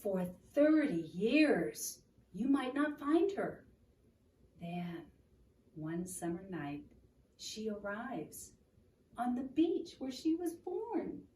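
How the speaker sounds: gentle read-aloud storytelling; story narration. Acted. Reading picture book aloud.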